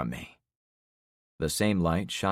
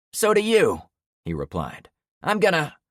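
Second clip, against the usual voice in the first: much higher, loud snarling retort; angry shout; 11 semitones higher, 6 dB louder than usual. Acted. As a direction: bark back before drawing. Trevor retorts before shooting.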